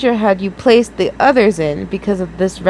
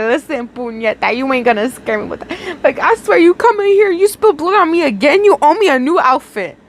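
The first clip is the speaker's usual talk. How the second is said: tough street talk; 4 semitones higher than usual. Pretend. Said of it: "I'd be like".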